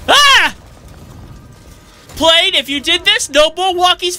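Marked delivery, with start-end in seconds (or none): 0.1-0.5 sharp high yelp; sudden high ah of shock; startled yelp. Real, not acted. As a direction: jolt and yelp. Startled cry.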